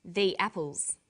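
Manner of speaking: "the apples" with "the" said thee; long ee in "the" before "apples"; thee before a vowel. Shown as a demonstration. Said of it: "comes before a vowel sound".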